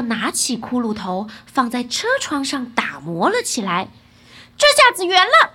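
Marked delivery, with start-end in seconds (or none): 4.6-5.5 high, loud delight ending in laughter; gleeful. A narrator's reading. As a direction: exclaim happily, then laugh. Boy delighted, laughs after polishing. (高声欢喜，带着笑)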